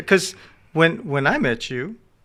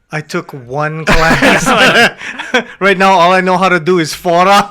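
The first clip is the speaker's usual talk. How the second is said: louder, slow joking while laughing; teasing a friend, laughing; laughing mock quote; 7 dB louder, slower than usual. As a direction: play the hopeless beginner through laughter. Mocking friend's Aikido skill.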